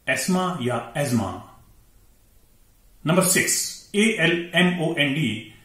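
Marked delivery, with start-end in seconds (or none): 0.1-1.4 एस्मा with s, एजमा with z, th silent; correct pronunciation. Shown as a demonstration. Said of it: "ये है". (सही उच्चारण, थ के बिना एस्मा या एजमा)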